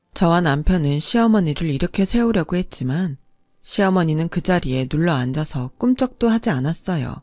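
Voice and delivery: ordinary delivery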